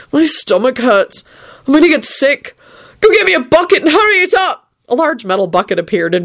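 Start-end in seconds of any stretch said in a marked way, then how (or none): 0.1-4.7 high, moaned complaint; moan, building to a high urgent demand. A narrator's reading. Neville is about to be sick, moaning. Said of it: "Neville moaned".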